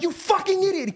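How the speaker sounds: high-pitched mock yell of a furious dad; imitated angry yell. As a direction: act out a dad about to yell. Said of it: "He's about to yell at me like".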